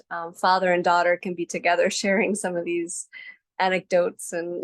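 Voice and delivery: ordinary talk, nothing marked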